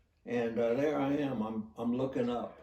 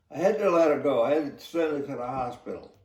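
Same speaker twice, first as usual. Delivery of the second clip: retold reply, pushed louder; raised voice; 4 semitones higher, 8 dB louder, faster than usual. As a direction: insist over his shouting. Officer shouts back explaining.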